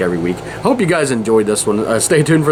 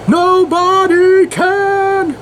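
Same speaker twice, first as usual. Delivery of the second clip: very high, long drawn-out mock cry; mock dramatic wail; 3 dB louder, much slower than usual. Host re-enacting the iconic scene, mock dramatic wail. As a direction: stretch each syllable out in a high, hammed-up wail.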